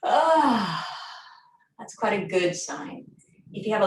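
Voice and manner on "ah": relieved sigh. Retold or imitated. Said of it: "and the body goes".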